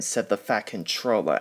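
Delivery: ordinary delivery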